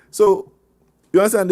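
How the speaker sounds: usual speaking voice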